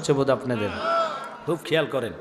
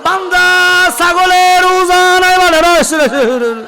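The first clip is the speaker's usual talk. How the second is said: very high, loud, drawn-out chant; full-force preaching cry; 12 semitones higher, 9 dB louder, slower than usual. Real, not acted. Preacher proclaiming the goat's miracle to the crowd, full-force cry. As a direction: belt it out slowly at the top of the voice.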